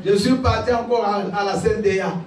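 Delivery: ordinary talk, nothing marked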